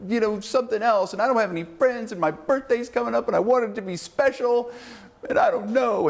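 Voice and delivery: put-on high, pleading teen girl voice; mock the upset teenager whining to Dad; whiny teenage girl. Cheerleader complaining to her dad, high whiny teen voice. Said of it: "upset like a teenager".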